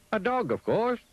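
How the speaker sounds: high and brightly sure; high Mister Dizzy. Acted. Mister Dizzy answers the pig, high and sure. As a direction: answer with easy confidence.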